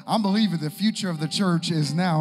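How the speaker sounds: forceful preaching. Real, not acted. Preacher pointing young people to the verse, loud forceful preaching. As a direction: drive the scripture home to the room.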